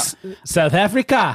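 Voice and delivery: a hammed-up South African accent. Shown as a demonstration. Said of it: "do your South African accent".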